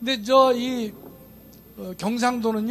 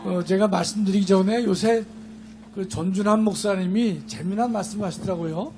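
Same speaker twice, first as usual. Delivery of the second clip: normal talking voice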